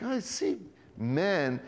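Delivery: slow, soft, drawn-out words; a squinting man straining to see; squinting slowly. Retold or imitated. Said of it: "all squinting".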